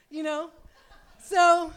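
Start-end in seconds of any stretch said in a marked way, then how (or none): none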